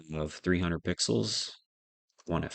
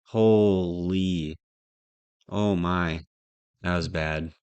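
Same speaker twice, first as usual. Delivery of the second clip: self-mocking disbelief; 9 dB louder, much slower than usual. Real, not acted. Groans at his own mistake.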